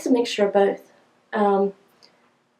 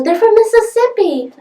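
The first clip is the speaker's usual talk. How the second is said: high, loud imitation of a child piping up; little girl; 13 semitones higher, 6 dB louder than usual. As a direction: blurt it out like an excited kid, pitched way up. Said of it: "the little girl spoke up".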